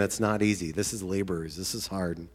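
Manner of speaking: ordinary talk, nothing marked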